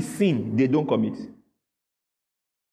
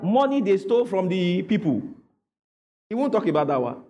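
ordinary delivery

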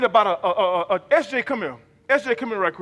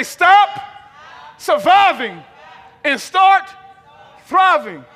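punchy shout, broken up with pauses; rallying preacher's chant in short bursts; loud call-and-response; 4 semitones higher, 5 dB louder, much slower than usual. Real, not acted. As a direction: call it out for the crowd to repeat. Preacher leads congregation in repeating slogan.

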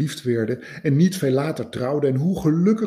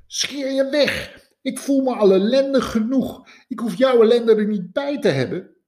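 high, loud, furious queen voice; queen lashing out at the scientist; angry queen; 12 semitones higher, 5 dB louder than usual. Acted. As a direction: snap at him to get out. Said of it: "zei ze".

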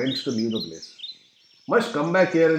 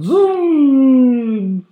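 higher and louder, drawn-out zoom falling away; zoom sound; 9 semitones higher, 8 dB louder than usual. Pretend. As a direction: sing out the bike speeding off. Bike racing downhill.

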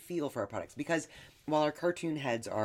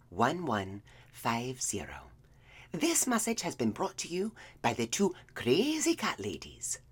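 breathy whispered ad read; whispering. Acted. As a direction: whisper it like a naughty secret. Whispered sponsor line.

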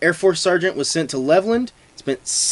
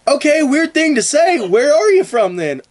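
much higher, louder, laughing disbelief; suspicious local; 11 semitones higher, 5 dB louder than usual. Acted. Postwar local grilling a German, high laughing suspicion. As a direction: laugh through mock suspicion, high and loud.

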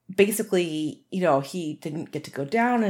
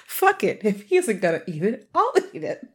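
dog's inner voice. Retold or imitated. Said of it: "because he figures".

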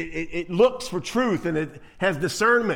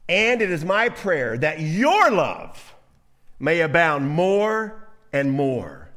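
solemn scripture reading; 5 dB louder, much slower than usual. Acted.

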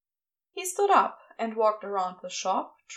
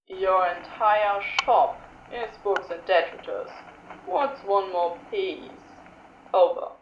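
Crowley voice; 4 semitones lower than usual. Acted.

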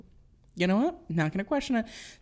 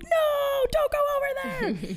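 put-on much higher, panicked kid cry; frantic warning. Kids frantically warning Scotty off the fence. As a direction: cry out in alarm to stop him.

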